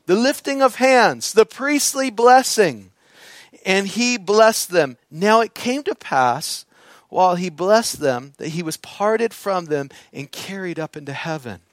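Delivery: loud, fervent preaching voice; full-force sermon delivery. Acted. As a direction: preach it out over the congregation.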